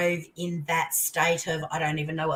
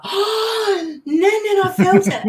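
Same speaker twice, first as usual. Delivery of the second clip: put-on high, loud, amazed child's voice; a little boy stunned by what he felt; imitating her grandson's amazed cry; astonished grandson; 9 dB louder, slower than usual. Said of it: "He's just like".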